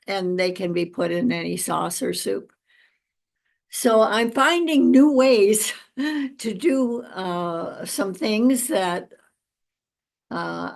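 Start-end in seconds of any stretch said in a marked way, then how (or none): 3.7-7.0 chuckling through the words; amused, with a laugh breaking in. Real, not acted. Amused at her own adapting.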